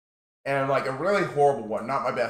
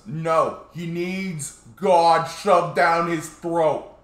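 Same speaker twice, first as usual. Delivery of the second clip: loud, slow, higher insistent preacher; 5 semitones higher, 8 dB louder, much slower than usual. Retold or imitated. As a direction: drive it home like a pushy preacher. Preacher arguing with the manager.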